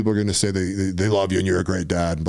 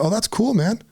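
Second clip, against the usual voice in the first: much higher, light and breezy; high mock approval; 11 semitones higher than usual. Dad answering his son, high and breezy. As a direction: toss it off high like it's no big deal. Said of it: "I was like".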